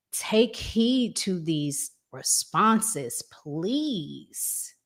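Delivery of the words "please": ordinary delivery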